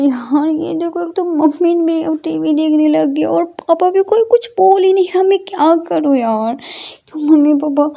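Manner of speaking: high fretful thinking aloud; anxious inner voice. A narrator's reading. Brother worrying inside his head, high and fretful. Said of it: "मन में बोलते हैं".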